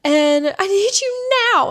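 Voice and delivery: high, loud hysterical mimicry; mock hysterical. Boss calling the advisor, mock hysterical. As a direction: wail for help like she's the victim. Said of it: "and, like, hysterical".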